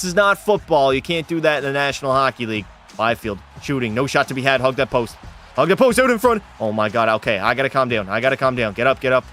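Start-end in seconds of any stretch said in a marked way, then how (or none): 5.6-6.4 higher and louder, urging the goalie; urgent yell. Real, not acted. Streamer yelling at his goalie to hug the post. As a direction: shout instructions at the screen.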